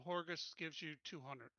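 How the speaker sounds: usual speaking voice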